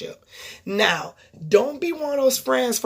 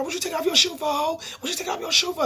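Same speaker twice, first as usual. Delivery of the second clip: mock hype yell; 8 semitones higher, 3 dB quieter, faster than usual. Apple Watch getting hype at her.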